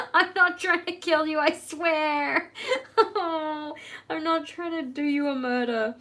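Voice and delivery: high, loud, hammed-up sing-song apology; dramatic apology. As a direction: wail the sorry, stretched out.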